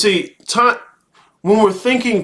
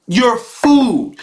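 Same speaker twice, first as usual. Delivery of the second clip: ordinary talk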